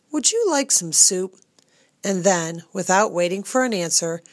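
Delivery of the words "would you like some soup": voicing Cook. A narrator's reading. Cook offers soup.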